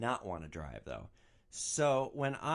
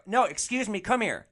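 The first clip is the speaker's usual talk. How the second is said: raised, higher voice demanding attention; insistent calling out; 9 semitones higher, 8 dB louder than usual. Retold or imitated. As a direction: call over someone who is ignoring you. Insistent call to ignoring meter maid.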